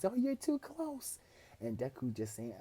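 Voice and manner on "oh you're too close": very high, drawn-out squeal; flustered protest. Acted. Girl hitting Deku as he gets too close, squealed protest. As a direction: protest in a stretched falsetto.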